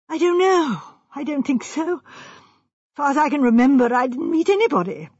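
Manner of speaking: lightly voiced worried young man; uneasy young architect. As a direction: answer hesitantly under questioning. Architect uneasily answers questioning.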